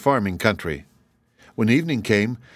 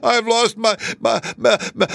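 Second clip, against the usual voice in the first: very high, stuttering cartoonish wail; 13 semitones higher, 6 dB louder than usual. A narrator's reading. Kangaroo tells the travellers of a loss, wailing. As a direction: bawl it out, stuck on the word, breaking into sobs. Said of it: "wailed the kangaroo".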